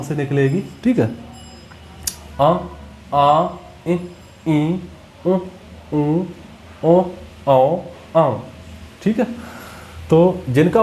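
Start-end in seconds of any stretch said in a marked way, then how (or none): 2.4-8.4 quick run of अ आ इ ई उ ऊ ओ औ, nasalized; nasal vowel drill. Shown as a demonstration. Teacher drilling the students in nasal vowels, nasalized. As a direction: run through the vowels with air through nose and mouth. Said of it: "इनको बोलकर देखिए।".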